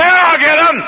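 high, loud mock threat; vengeful threat. Preacher voicing the revenge Joseph didn't take: loud threat.

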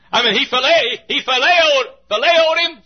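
shouted high through laughter; laughing exclamation. Acted. Preacher tickled by his own word, laughing shout. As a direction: belt it out laughing at the odd word.

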